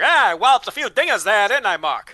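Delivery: put-on old-timey voice. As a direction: ham up a fifties slugger. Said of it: "old-timey voice".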